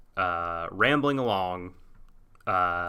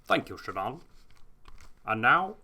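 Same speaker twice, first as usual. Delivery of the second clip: Sean Connery newscaster; 3 semitones higher than usual. Pretend. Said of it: "sounding more and more like Sean Connery".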